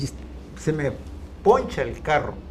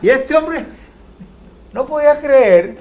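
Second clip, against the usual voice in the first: higher and louder, laughing as he tells it; excited laughing punchline; 5 semitones higher, 5 dB louder than usual. Real, not acted. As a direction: build to the punchline through laughter. (contando el remate entre risas, más agudo y más fuerte)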